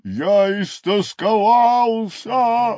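loud, drawn-out wail of regret; grieving rhino, stretching the words; anguished rhino lament. Acted. Rhino laments, beats his head.

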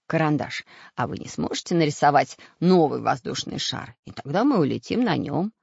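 usual speaking voice

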